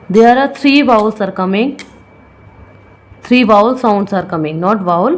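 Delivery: usual speaking voice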